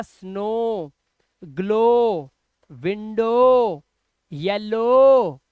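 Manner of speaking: long held 'oh' ending on स्नो, ग्लो, विंडो, येलो. Shown as a demonstration. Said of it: "तो ओ से बना हमारा".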